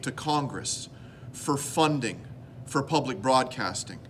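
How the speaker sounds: low-key, measured confiding; heartfelt monologue. Acted. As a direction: talk intimately and gravely to the viewer.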